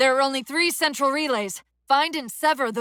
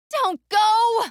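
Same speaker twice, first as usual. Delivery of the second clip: high, louder desperate call; pleading cry; 10 semitones higher, 3 dB louder than usual. Acted. AI begging the Chief not to go, high pleading cry. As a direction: cry out to stop him leaving.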